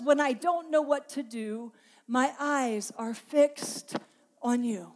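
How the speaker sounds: my eyes are fixed drawn out very slowly; slow, deliberate scripture reading; slow emphatic quote. Shown as a demonstration. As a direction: read the verse slowly, weighting each word. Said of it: "that when I don't know what to do".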